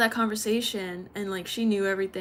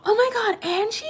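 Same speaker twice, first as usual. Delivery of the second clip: put-on excited old friend; 13 semitones higher, 7 dB louder than usual. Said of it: "she's like".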